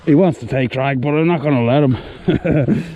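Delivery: loudly narrating, strained with effort; loud and excited. Real, not acted. Fighting a big fish.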